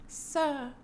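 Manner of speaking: high, soft-edged mock British accent; romantic British accent. Mimicking the actress in a romantic scene, mock British accent. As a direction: put on a stilted British lady's voice. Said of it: "it was just like".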